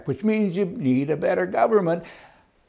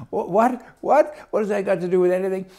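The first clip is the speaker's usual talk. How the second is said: incredulous what, what, rattled off quickly; stunned disbelief; 5 semitones higher than usual. Retold or imitated. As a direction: pitch jumps high on the repeated what, then rushes fast. Baffled by teller's political remark.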